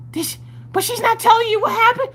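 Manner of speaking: loud high frantic mom; frantic shout. Retold or imitated. Frantic mom in retold argument.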